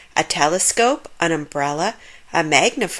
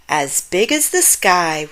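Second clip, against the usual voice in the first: ordinary delivery, nothing marked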